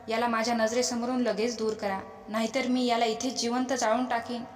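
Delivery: usual speaking voice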